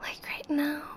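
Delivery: ordinary talk, nothing marked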